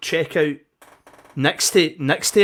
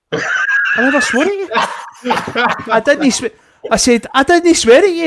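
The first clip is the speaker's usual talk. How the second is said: indignant denial; 10 semitones higher, 3 dB louder than usual. Retold or imitated. Retold denial in argument.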